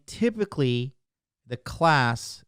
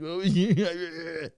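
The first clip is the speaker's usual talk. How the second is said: mock weirdo; 3 semitones higher, slower than usual. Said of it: "he's such a weirdo. He's like".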